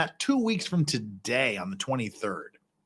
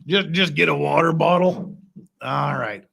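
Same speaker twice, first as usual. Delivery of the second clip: ordinary talk, nothing marked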